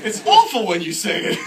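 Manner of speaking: loud, high comic protest; hammed-up frustrated yelling; exasperated complaint. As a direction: wail the complaint in mock despair. Retold complaint to mother.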